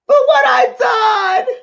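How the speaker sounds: high, slow wailing answer; crying out. Retold or imitated. Arrested woman answering reporter, wailing. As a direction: cry the confession out loud. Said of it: "She's bawling".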